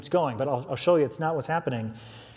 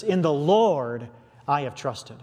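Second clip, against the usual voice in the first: "In the Lord" slow and weighted, rest trailing; slow, put the weight on "In the Lord"; stress on In the Lord; slower than usual. Shown as a demonstration. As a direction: lean hard on "In the Lord" up front, then ease off. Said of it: "writing it with this emphasis".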